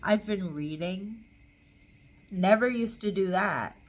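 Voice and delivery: ordinary talk, nothing marked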